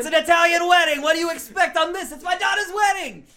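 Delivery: high, loud Italian-American dad; Italian yelling. Acted. Father defends noisy Italian wedding, high indignant yelling. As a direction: yell it proudly at the coordinator. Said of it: "We're gonna yell".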